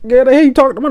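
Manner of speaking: high, exaggerated disbelief; disbelieving squeal. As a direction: sound like she's lost her mind. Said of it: "I was like".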